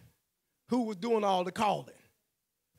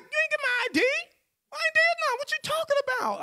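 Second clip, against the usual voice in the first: much higher and louder, outraged; indignant protest; 7 dB louder, faster than usual. Retold or imitated. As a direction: push back hard at the officer. Arguing with the cop.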